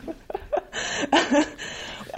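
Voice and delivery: unvoiced laughing fall; breathy laugh. Real, not acted. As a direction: laugh softly at the memory. Laughing after quote.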